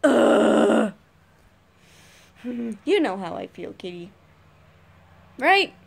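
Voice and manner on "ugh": loud, harsh groan of annoyance; frustrated groan. Retold or imitated. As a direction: let out a fed-up groan. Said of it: "everybody in the car was just getting really mad".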